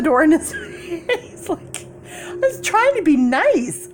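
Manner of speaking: high, giggling through the words; real giggles; laughing. Real, not acted. Laughing at own fantasy.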